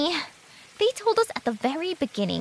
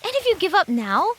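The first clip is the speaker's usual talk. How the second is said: urgent plea; 11 semitones higher than usual. Acted. Urging friend not to give up.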